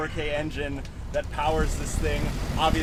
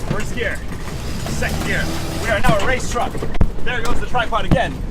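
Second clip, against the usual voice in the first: loud, higher, thrilled; excited shout; 7 semitones higher, 8 dB louder than usual. Real, not acted. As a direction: cheer as the truck speeds up. Launching the truck.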